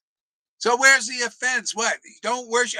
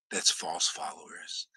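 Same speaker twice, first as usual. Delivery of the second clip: soft, much lower hushed whisper; 10 dB quieter than usual. Real, not acted. Preacher confiding about false followers of Lord Chaitanya, whispering. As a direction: drop to a secretive whisper.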